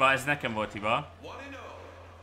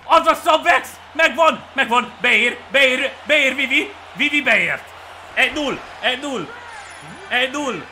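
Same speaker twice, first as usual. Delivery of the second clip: high, very loud excited play-by-play; triumphant shouting; 9 semitones higher, 8 dB louder than usual. Real, not acted. Gamer celebrating Vivi scoring, triumphant excited shouting. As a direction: shout each call as the run comes in.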